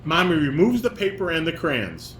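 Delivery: ordinary talk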